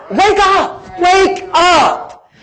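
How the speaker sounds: high, drawn-out wake-up call; Paul. Retold or imitated. Paul rousing the Roman church, acted high wake-up call. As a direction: call out high to rouse sleepers.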